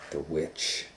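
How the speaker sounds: plain reading voice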